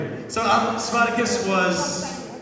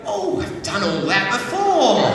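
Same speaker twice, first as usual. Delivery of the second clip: put-on unfazed old lady; 2 semitones higher, 4 dB louder than usual. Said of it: "And they go".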